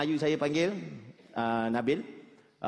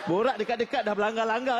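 ordinary talk, nothing marked

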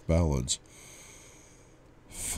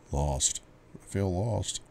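sad; 2 semitones higher, 8 dB quieter, slower than usual. Acted. Confessing his loss to therapist.

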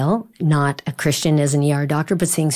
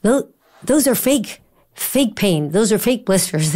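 mock teasing coach; 5 semitones higher than usual. Coach teasing Chris about pain.

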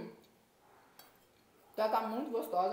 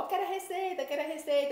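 eager fans; 8 semitones higher, 4 dB louder, faster than usual. Retold or imitated. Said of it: "o pessoal".

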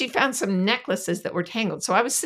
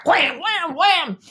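sound effect; 9 semitones higher, 5 dB louder, much slower than usual. Retold or imitated. Repeated hairbrush blows.

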